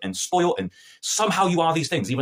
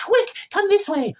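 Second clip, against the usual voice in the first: urgent Marian; slower than usual. Retold or imitated. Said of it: "Great levels of tension".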